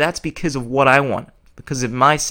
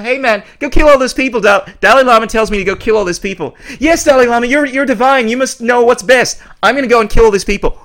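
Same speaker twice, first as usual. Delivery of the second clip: high, loud sarcastic follower voice; mocking a yes-man who kills on command; mock devout follower; 7 semitones higher, 6 dB louder than usual. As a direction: ham up the blind believer obeying orders. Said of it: "If they tell".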